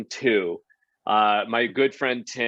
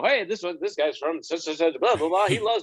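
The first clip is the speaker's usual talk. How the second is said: high, loud, tumbling host patter; rushed host intro; 8 semitones higher, 5 dB louder than usual. Retold or imitated. Host reading contestants' cards, laughing through it. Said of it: "you have to say it super quickly".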